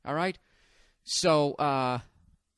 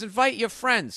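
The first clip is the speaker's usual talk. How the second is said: higher-pitched take-off of a woman; imitating partner; 6 semitones higher than usual. Partner telling him to invite friends, mimicked high. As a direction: mimic her breezy suggestion, pitched up. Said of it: "So we, so she goes".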